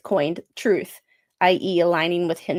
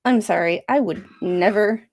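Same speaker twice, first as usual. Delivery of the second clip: usual speaking voice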